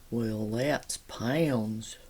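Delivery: slow, low imitation of husband's heavy accent; mock husband's accent, vowels stretched long; husband's drawling accent. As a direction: drag it out deep and slow in his accent. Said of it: "my husband does talk like that".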